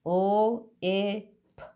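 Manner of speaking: each letter sound said separately, with pauses between. Shown as a demonstration. Teacher to pupils, sounding out a word letter by letter. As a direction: split the word into its single sounds for the class. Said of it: "If you're saying".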